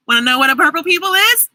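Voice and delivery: ordinary delivery, nothing marked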